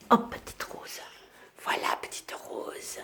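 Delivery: whisper. Real, not acted. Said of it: "whispering to the puppy".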